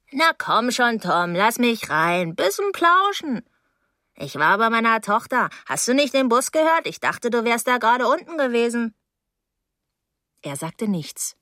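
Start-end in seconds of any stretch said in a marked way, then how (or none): 0.1-8.9 swooping, wheedling woman's voice; coaxing older neighbour. Acted. Mrs Deering wants in for a chat, coaxing. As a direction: coax your way in, stretching the words.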